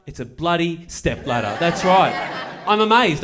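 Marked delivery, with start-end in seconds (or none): none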